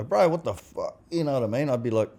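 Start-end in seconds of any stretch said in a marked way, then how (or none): 0.0-1.0 imitating his old short-fused self, a bit higher and sharper; the guy who blows up over a spilled drink; mock hothead snap. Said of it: "I'd go".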